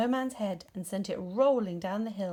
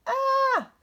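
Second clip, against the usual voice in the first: sudden high shriek of fright; startled high cry; fright yelp; 9 dB louder than usual. A narrator's reading. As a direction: yelp as if jumped out of your skin. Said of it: "jumped his own height in fright".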